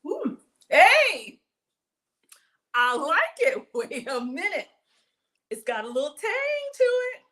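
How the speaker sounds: high, loud squeal of delight; thrilled by the first taste; excited delight. Real, not acted. Tasting the dressing from spoon.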